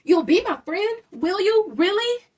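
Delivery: high, loud hopeful disbelief; can't believe someone wants to play; excited high really; surprised hope. Acted. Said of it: "looked surprised".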